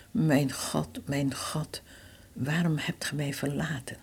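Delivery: breathy, fading whisper of a dying man; anguished whisper. Acted. Jesus crying out on the cross, whispered in agony. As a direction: let each word barely escape, drained.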